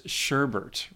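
sherbet said as "SHUR-burt"; sherbert pronunciation. Shown as a demonstration. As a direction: add an extra r to the end of sherbet, making it "-bert". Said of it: "for years".

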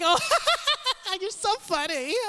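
mocking a practiced fake laugh. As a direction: overdo a forced laugh at his joke. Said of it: "practicing your fake laugh like".

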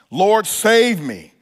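drawn-out, deliberate plea with weight on save; slow salvation cry. Shown as a demonstration. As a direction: stretch every word like a heartfelt cry. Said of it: "here's what you cry out".

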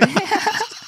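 squeaky laugh at own joke; high burst of laughter. Real, not acted. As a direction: break into a high giggle.

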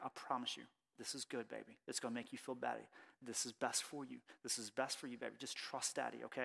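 very soft, low soothing; retold hushed dad comforting his baby; gentle start. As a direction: quietly reassure a frightened child, close and tender. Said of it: "at first I start like this".

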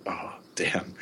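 a hushed, breathy oh damn under the breath; whispered dismay. Retold or imitated. Embarrassed student whispers as the class stares at him. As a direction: whisper it so the teacher won't hear. Caught beside a sleeping friend.

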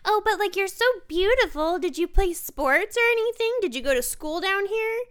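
high, loud gushing fake compliment; mock sweet lady. The rude lady gushing at the narrator, mocked in a high loud sugary voice. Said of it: "And she goes".

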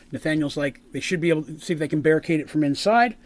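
ordinary delivery

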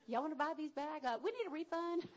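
lightly voiced women asking for a refund, jokey; playful put-on customer voice; mock shopkeeper plea. Preacher voicing the women asking for a refund, playful mock voice. Women returning spices, joking voice.

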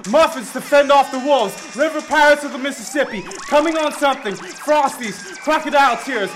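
usual speaking voice

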